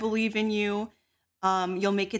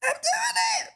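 excited shout. Acted. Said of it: "I'm stretching".

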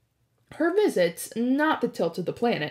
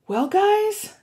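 nervous hero; 12 semitones higher, 5 dB louder than usual. Acted. Hero voiced at doors.

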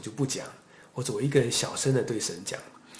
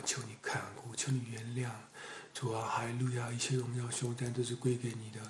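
hushed prayer; 2 semitones lower, 8 dB quieter than usual. Real, not acted. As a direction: pray quietly and humbly to God. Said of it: "让各位弟兄姐妹看看什么是真的方言".

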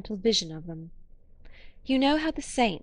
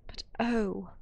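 crying out; 4 dB quieter than usual. A narrator's reading. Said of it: "she cried".

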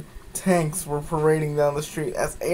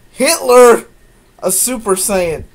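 loud, high, disbelieving outburst; mock astonished; 7 semitones higher, 9 dB louder than usual. Real, not acted. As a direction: blurt it out in disbelief. Reader mocking the twist.

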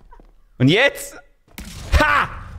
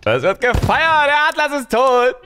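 mock celebration; 9 semitones higher than usual. Real, not acted. Celebrating a death.

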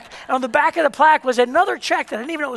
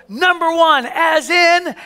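announcer; 6 semitones higher, 4 dB louder, much slower than usual. Retold or imitated. Announcer builds up to the winner.

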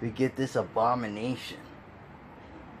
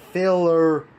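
exasperated yell; 4 semitones higher, 7 dB louder than usual. Real, not acted. Frustrated ranting about filler.

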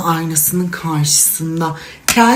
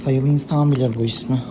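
ordinary delivery

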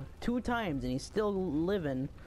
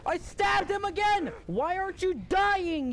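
high, loud excited gamer yelling; 11 semitones higher, 5 dB louder than usual. Real, not acted. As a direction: yell it out mid fight. Kid yelling mid game fight.

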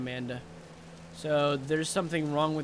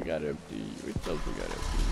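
very deep voice; 8 dB quieter than usual. Pretend. Gamer repeating his question in a deep goofy voice. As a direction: drop far lower into a goofy deep rumble.

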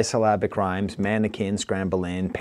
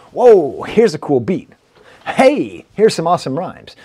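louder, with a burst on whoa; mock excited listener; 3 semitones higher, 6 dB louder than usual. Reviewer repeating the gushing for the next track, mock hyped. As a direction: gush again, mock hyped. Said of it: "Next track".

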